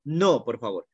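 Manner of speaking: normal talking voice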